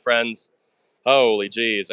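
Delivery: ordinary talk, nothing marked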